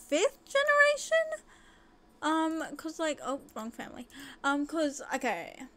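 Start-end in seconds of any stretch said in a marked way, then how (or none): 0.1-1.4 amazed. Real, not acted. Amazed at family length.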